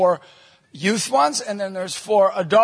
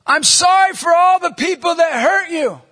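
high, loud call across a street; shouted apology; 9 semitones higher, 7 dB louder than usual. Retold or imitated. Stranger calling an apology to two hurt kids, shouting. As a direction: project every word to someone far away.

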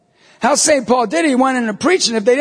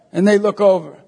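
usual speaking voice

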